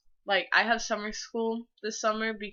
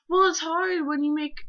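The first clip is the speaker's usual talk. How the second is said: higher, louder, almost crying; gushing; 8 semitones higher, 5 dB louder than usual. Retold or imitated. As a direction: pour it out emotionally. Reading her gushing text aloud.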